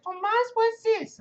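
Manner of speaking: put-on very high little-kid voice with Spanish accent; loud, high Spanish-accented kid question; kid's Spanish accent. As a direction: imitate a curious child with a Spanish accent, slow and squeaky. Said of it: "they have that Spanish accent".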